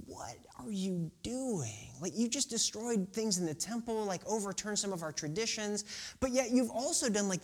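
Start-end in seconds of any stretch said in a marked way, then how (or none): 0.1-1.9 slow, whispered bewilderment; hushed, puzzled whisper; whispered question. Acted. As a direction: breathe the question in confusion.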